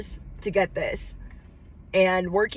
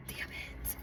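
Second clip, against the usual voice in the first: whispered through crying; real tearful curse; 20 dB quieter than usual. Real, not acted. Annoyed at herself for crying on camera, whispered. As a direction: curse softly at your own tears. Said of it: "let me not get my tears on it".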